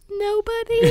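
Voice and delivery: soft, high-pitched mock-innocent answer; small high voice, retold; high awkward reply.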